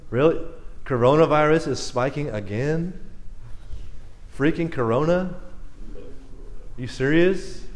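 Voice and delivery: low, weary questions with rising ends; can't believe this is happening, said quietly; exasperated disbelief. Acted. Acting out exasperation at bad news.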